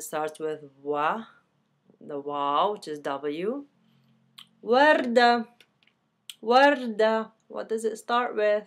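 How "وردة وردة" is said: وردة said loud, opening w punched; وردة loud, stress on the w; emphatic model word. Shown as a demonstration. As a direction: call out وردة to the class. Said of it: "It starts with the waw".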